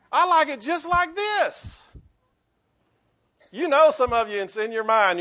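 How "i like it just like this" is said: loud high mock-stubborn line with a laugh; insist, laughing, on keeping things as they are; stubborn insistence. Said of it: "how many of you went".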